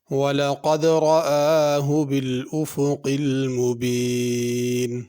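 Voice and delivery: slow, drawn-out chanted recitation; measured melodic tilawat; Quran recitation. Shown as a demonstration. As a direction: recite it like the teacher modelling tajweed. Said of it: "teacher recites verse to model tajweed".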